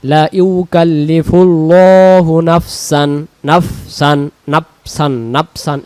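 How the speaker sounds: loud, slow recited line; recited, then drilled. Shown as a demonstration. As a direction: project the verse, then repeat নাফসান. Teacher recites and repeats word.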